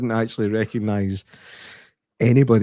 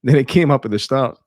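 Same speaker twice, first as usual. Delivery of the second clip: ordinary talk, nothing marked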